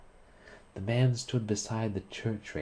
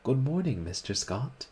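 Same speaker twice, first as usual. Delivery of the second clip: bright, a bit higher and louder hello; merry greeting; 7 semitones higher, 3 dB louder than usual. A narrator's reading. Model greeting the painter merrily. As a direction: chirp a cheerful good morning. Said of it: "with a merry".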